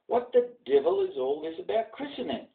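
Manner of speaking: put-on high startled boy's voice; a lightly voiced scared lad; young boy alarmed at overhearing; frightened boy. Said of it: "he muttered in fright".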